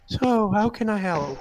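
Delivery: lightly voiced mystic woman; a hint of a gentle seer; fortune teller voice. Fortune teller asks the visitors.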